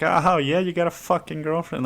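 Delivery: high, loud mocking singsong; mock him for having a girlfriend; teasing. Brother teasing about a girlfriend, high mocking taunt. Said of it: "just teasing you and fucking making fun".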